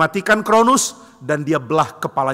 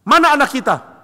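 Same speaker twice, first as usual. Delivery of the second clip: demanding; 9 semitones higher, 4 dB louder than usual. Acted. Cronus demands the child.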